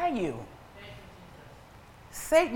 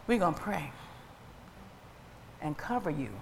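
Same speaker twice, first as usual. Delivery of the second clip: low, soft and measured; hushed, low-pitched counsel; quiet resigned reply; 5 semitones lower, 10 dB quieter than usual. Retold or imitated. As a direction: drop right down, pausing between phrases. Gentle reply to someone leaving church.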